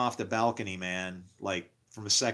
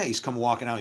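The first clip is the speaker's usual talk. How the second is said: normal talking voice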